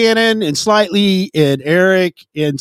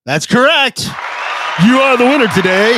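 mock game-show host; 7 semitones higher than usual. Co-host rewarding the host's guess, big mock game-show announcement. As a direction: announce the winner with hammed-up fanfare.

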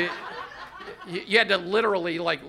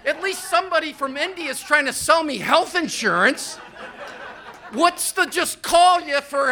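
exasperated rant; 10 semitones higher, 6 dB louder than usual. Real, not acted. Ranting about silent calls.

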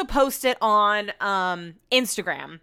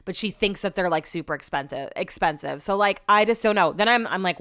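normal talking voice

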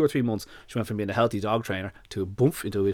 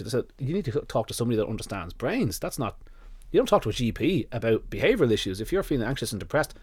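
ordinary talk, nothing marked

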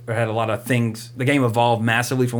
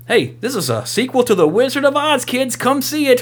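put-on overexcited trailer announcer pitching to kids; mock movie trailer pitch; 12 semitones higher than usual. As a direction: sell the sequel to kids like a cheesy ad. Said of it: "This movie's about".

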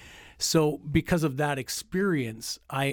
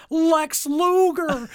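slow booming announcer intro, much higher; boxing ring announcer; 8 dB louder, much slower than usual. Retold or imitated. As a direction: belt the name out to the arena. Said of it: "ring announcer voice".

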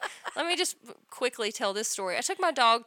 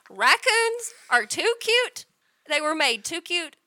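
gushing; 5 semitones higher, 4 dB louder, slower than usual. Real, not acted. Gushing over raccoons.

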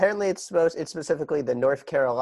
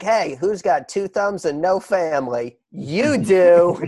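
hammed-up taunt, louder on the punchline; gleeful taunt; 3 semitones higher, 5 dB louder, slower than usual. Podcaster mocks Beth breaking news of the lost family, gleeful taunt. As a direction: crow it like a cheesy gag, landing hard on the end.

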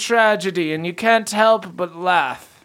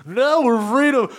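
higher voiced bee man character; 10 semitones higher than usual. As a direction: squeak it like a giddy bee man. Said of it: "They're still bee men".

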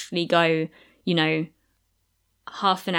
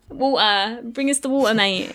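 higher and brash, a Cockney "water" with the T dropped; playful Cockney "Bring us the water, mate"; common London accent; 4 semitones higher, 4 dB louder than usual. Shown as a demonstration. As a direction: drop the T and call out for it like a Cockney lad. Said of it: "If Harry Potter was a bit common".

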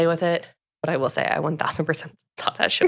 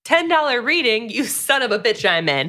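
a laughing, higher mock-eager quip; half-laughing, raised voice; 6 semitones higher than usual. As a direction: play it like she can't resist a bargain. Heroine's eager quote, laughing.

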